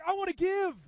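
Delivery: high loud eager voice of a generous elder; excited giving. Retold or imitated. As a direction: burst out with joy to give. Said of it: "He's like".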